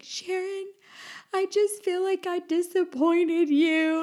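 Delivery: high, slow, teary half-laughing plea; mock sobbing. Apologizing to her mentor Sharon: mock crying, high and slow. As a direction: replay the tearful apology with a laugh. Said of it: "I sobbed".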